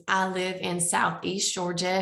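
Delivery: usual speaking voice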